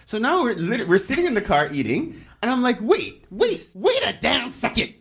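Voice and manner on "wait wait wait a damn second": high-pitched, indignant halt, drawn out at the end; mock-outraged slamming on the brakes; livid retelling. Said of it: "I get livid".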